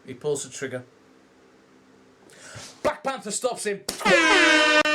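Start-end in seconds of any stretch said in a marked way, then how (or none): 3.9-4.9 high, loud cry of joy, much higher and louder; excited shout. Real, not acted. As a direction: burst out with delight at the rescue. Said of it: "So happy with this".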